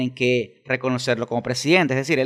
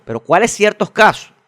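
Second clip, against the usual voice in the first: indignant challenge; 7 semitones higher, 6 dB louder than usual. Real, not acted. Challenging a retold position, indignant.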